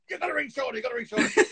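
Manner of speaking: high, eager, insistent reminder; wife excitedly reminding her partner to phone a fan; excited urging. Retold or imitated. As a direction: hurry her partner along, thrilled. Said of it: "Tara's going".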